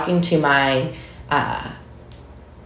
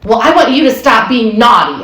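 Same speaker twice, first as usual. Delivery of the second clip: higher, louder mock snap back at the child; snappy retort; 6 semitones higher, 5 dB louder than usual. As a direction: throw the blame back, half-hearted sharpness. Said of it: "my response was something like".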